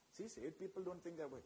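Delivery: soft, sly accusing murmur; the devil hushed, slipping a lie into your ear; the devil's sly voice. Retold or imitated.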